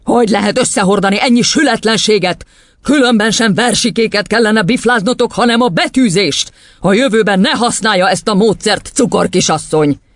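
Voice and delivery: high, indignant tirade; tyrant headmistress, scornful. Acted. Headmistress banning the teacher's method, indignant scolding.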